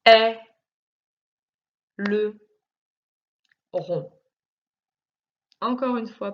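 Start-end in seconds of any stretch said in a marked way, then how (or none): 0.0-4.1 "Est le rond" as three isolated syllables; long pauses between each beat of "Est le rond"; split into syllables. Shown as a demonstration. Teacher correcting the syllable exercise, split into syllables. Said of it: "première".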